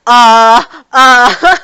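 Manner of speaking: loud caught-out uh uh; stall, caught out; flustered stammer. Retold or imitated. Toru, caught guessing, stalls in a loud flustered stammer.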